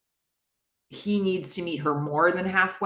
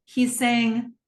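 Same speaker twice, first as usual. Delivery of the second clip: ordinary talk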